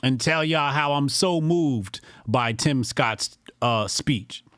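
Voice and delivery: usual speaking voice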